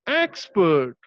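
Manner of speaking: "expert" with a strong EX and a falling, lighter PERT; stress on EX. Shown as a demonstration. Teacher modelling syllable stress, "expert" stressed on EX. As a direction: lean on EX at the start of "expert". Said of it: "how to stress upon this syllable".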